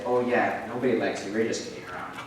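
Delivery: low, hushed brush-off; dropped low and quiet; dismissive. Retold or imitated. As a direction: quote a casual cruel dismissal, very softly. Retells girls' cruel brush-off.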